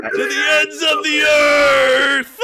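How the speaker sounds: high, very loud, drawn-out yell; hammed-up hero scream. Finishing a friend's Spider-Man quote, melodramatic scream. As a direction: stretch every word into an overacted battle cry.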